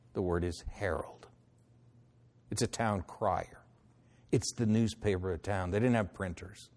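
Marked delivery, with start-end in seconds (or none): none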